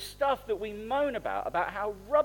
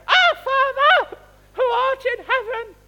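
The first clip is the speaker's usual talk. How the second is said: much higher and louder, slow; a small boy bellowing the prayer as loud as he can; little boy shouting; 14 dB louder than usual. Retold or imitated. Little boy joining in beside his dad in church, high and loud. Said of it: "a little voice going".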